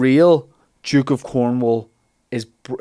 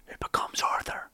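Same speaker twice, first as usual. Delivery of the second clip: soft unvoiced aside; storyteller whispering the name; dramatic whisper; 11 dB quieter than usual. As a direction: breathe it like a secret reveal. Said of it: "dramatic aside".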